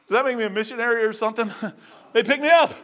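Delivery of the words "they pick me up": excited. Real, not acted. Excited retelling of being supported.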